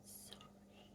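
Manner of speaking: faint breathy "Sorry."; whispered sorry. Shown as a demonstration. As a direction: barely breathe the word "Sorry.". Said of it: "the word sorry".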